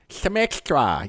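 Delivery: high, hammed-up gum commercial voice on "some extra"; chewing gum ad voice. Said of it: "you talk like that when you have some extra".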